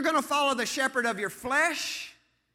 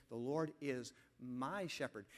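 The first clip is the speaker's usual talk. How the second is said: soft, low verse with weight on "my"; low, measured emphasis on "my"; stress on "my"; 9 semitones lower, 13 dB quieter than usual. Shown as a demonstration. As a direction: quietly set "my" apart before "shepherd". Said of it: "put the emphasis on the word "my".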